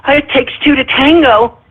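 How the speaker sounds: a light mock of a protester's excuse; lightly mocked objector. Host mimicking single mothers' expected comeback, lightly mocked objector. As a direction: toss it out as someone else's feeble objection. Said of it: "they're gonna say".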